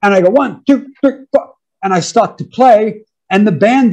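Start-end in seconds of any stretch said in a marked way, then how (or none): 0.3-1.5 high, evenly punched count; imitating a count-off on the sticks; drummer's count-in. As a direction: count the band in at a raised pitch. Acts out second count-in.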